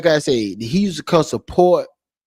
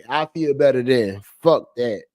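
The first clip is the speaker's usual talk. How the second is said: normal talking voice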